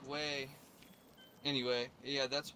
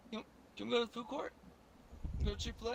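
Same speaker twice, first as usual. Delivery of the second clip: put-on higher, fast wheedling girlfriend; 8 semitones higher, much faster than usual. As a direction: rush the request like a pushy girlfriend. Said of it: "she was like".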